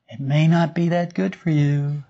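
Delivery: lightly voiced little conscience; gently persist as the small inner voice; quiet inner voice. Inner voice persisting after being told to shut up, lightly voiced caution. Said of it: "it's a very quiet part of us".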